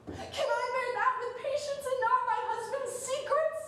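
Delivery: pleading. Acted. Portia pleads with Brutus.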